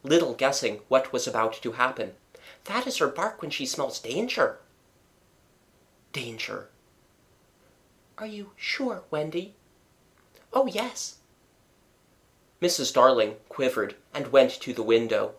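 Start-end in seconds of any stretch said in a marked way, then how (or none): 2.7-4.6 soft, high warning; knowing girl. A narrator's reading. Wendy explains Nana's bark, hushed warning. 6.1-9.6 very soft, anxious question; hushed alarm. A narrator's reading. Mother questions Wendy about danger, hushed alarm. 10.5-11.2 put-on high-pitched girl's voice; Wendy's assurance. A narrator's reading. Wendy assures her mother, high child voice.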